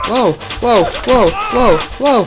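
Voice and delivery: rising shrieks of amazement; shrieking jackpot winner. Real, not acted. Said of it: "Level-Chaos, Schrei". (immer höher kreischend vor Staunen)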